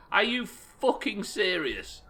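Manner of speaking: slow, loud disbelief; disbelieving shout. Real, not acted. Quest is seven hundred miles away.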